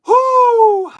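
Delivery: loud, high whoop of real agitation; worked-up whoop. Real, not acted. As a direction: let out a big fired-up holler. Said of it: "I'm worked up, folks".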